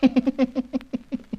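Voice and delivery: a villain's gloating chuckle; sinister laugh. Acted. Scientist laughing at the intruders, sinister. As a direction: laugh like a madman in his lair.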